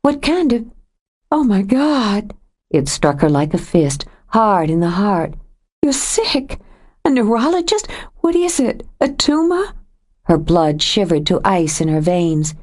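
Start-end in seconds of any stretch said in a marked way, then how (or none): 0.0-6.6 a much higher, alarmed woman's voice; Joe, suddenly frightened. A narrator's reading. Joe realising Nathan might be ill, alarmed and frightened. 7.1-7.9 high, rising shocked question; alarmed echo. A narrator's reading. Joe echoing Nathan's word, shocked and rising. 8.2-9.7 a much higher, alarmed woman's voice; Joe, suddenly frightened. A narrator's reading. Joe realising Nathan might be ill, alarmed and frightened.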